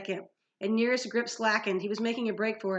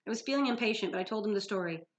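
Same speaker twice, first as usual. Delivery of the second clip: plain reading voice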